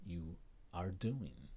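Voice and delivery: ordinary delivery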